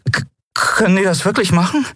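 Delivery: put-on high, stammering young voice; frightened. A narrator's reading. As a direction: stumble on the first word in alarm. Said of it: "riss erschrocken die Augen auf".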